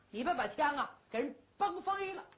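usual speaking voice

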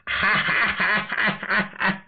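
big rolling villain's belly laugh; gleeful laugh. Acted. Villain spots his captured enemy, gleeful laugh. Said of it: "乐了".

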